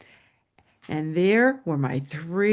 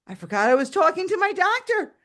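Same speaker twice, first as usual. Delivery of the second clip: ordinary talk, nothing marked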